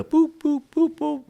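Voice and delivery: high, light boop sounds imitating taps; chirpy mock tapping sounds; playful tapping noises. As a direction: tap out each boop up high like a cartoon. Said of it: "I tapped everybody on the shoulder".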